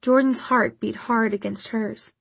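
usual speaking voice

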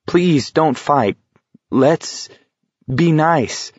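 high, slow, halting plea; a touch of fearful pleading; frightened. A narrator's reading. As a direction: beg timidly, pausing between phrases.

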